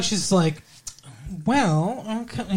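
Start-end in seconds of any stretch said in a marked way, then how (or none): 1.5-2.5 lightly voiced sitcom actress, pitched higher; higher, trailing off softly in mild mimicry; Jennifer Aniston impression. Showing co-host how she talks, a light higher-pitched impression. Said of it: "How does she talk?".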